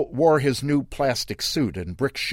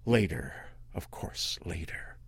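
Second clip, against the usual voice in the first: hushed, breathy aside; whispered aside; 6 semitones lower, 9 dB quieter than usual. A narrator's reading. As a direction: whisper it like a secret. Narrator drops to a whisper.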